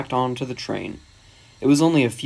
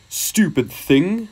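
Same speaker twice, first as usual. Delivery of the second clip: slow, higher-pitched grumpy engine; cross; 6 semitones higher, much slower than usual. A narrator's reading. Gordon after arguing with another engine, cross. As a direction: stretch it out in irritation. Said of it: "said Gordon crossly".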